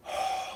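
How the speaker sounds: short, voiceless breath of enjoyment; acted appreciative breath. Guest walking into someone's home, savoring breath. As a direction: breathe in the smell of dinner. Acts walking in and smelling food.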